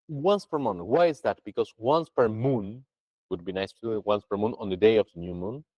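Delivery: ordinary talk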